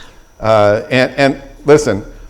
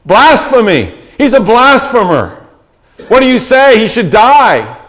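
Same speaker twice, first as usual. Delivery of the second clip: higher, louder shouts of "Blasphemy!"; accusing crowd; 8 semitones higher, 3 dB louder than usual. Retold or imitated. Sanhedrin crowd condemning Jesus: higher, louder outraged shouting. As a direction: cry out like an outraged council. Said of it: "hear the voices of the people in the Sanhedrin".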